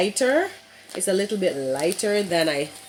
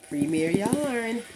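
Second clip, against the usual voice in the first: excited; 6 semitones higher, slower than usual. Acted. Announcing the yarn brand.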